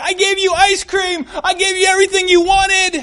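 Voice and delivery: high, loud mock shout; hammed-up, high-pitched parental outburst; exasperated parent yelling. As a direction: wail it like a parent at wit's end. Acts parent yelling at kids.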